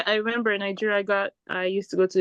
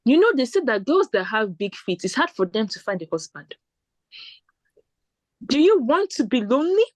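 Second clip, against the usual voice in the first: Nigerian auntie lecture; 3 semitones higher than usual. Retold or imitated. Shoe seller lecturing.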